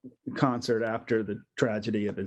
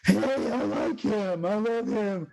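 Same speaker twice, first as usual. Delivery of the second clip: high, slow, gushing fan voice; mock fan gush; 9 semitones higher than usual. Fans meeting Brack's friends, high gushing fan voice. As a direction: gush over him like a starstruck fan. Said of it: "people will go".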